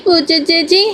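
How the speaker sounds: put-on high little-girl voice, ending on a rise; playful high child's call with a rising end; teasing child call. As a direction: sing it up at the end to tease her sister. Little girl calls to sister.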